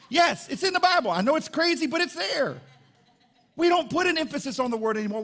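high, loud preacher's retort; emphatic preaching. Real, not acted. Preaches back at the doubters.

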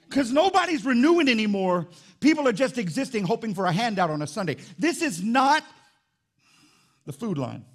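high, loud preacher rallying the congregation; full-force preaching. Real, not acted.

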